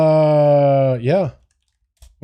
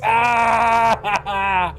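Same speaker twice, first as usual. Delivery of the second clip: sudden anguished shout; shocked cry; 4 semitones higher than usual. Real, not acted. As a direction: cry out in disbelief. Shocked at shutdown news.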